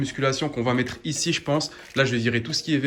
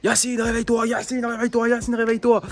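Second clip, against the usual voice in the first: wake-up call; 7 semitones higher than usual. Retold or imitated. Brother's wake-up call retold.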